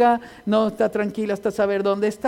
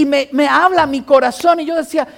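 usual speaking voice

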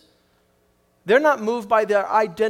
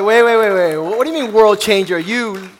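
put-on loud, scoffing disbelief at a puny girl; mock them as they laugh her off, loud; loud incredulous scoff; scornful mock shout; 8 dB louder than usual. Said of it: "They're like".

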